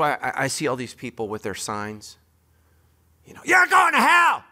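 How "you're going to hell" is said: high, loud, slow screamed condemnation; a hammed-up hateful shout; mock hellfire shout. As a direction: bellow it like an angry street preacher. Mocking sign-wielding street preachers.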